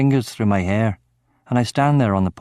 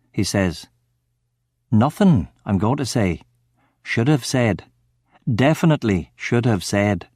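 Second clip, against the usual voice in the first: usual speaking voice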